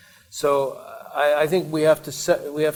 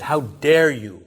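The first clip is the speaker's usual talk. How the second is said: mild mock yell, indignant, leaning on "dare"; imitating an angry reproach; retold angry yell; 4 dB louder than usual. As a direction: act out an outraged scolding, only slightly raised. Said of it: "he started yelling at Yusuf".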